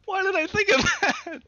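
very high, loud, slow wail through laughs; helpless laughter. Real, not acted. Player baffled by own voice choice, laughing wail. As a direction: squeal it out while cracking up.

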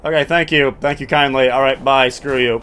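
ordinary talk

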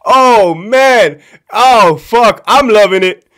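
loud, high, relishing delight; gleeful. Real, not acted. Storyteller revels in the captain getting schooled, loudly gleeful. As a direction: savor it with big gleeful exclamations.

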